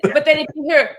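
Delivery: ordinary talk, nothing marked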